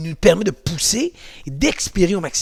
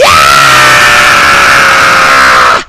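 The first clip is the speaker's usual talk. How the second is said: loud, low full-throated scream; coaster scream; 17 dB louder than usual. Acted. Instructor screams with the class on the pretend coaster, loud. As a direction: let out a ride scream. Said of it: "On crie".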